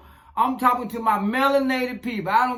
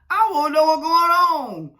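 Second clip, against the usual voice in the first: higher, louder mock alarm; mock shock; 6 semitones higher, 3 dB louder than usual. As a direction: recoil at something wild. Shocked at unshaved women.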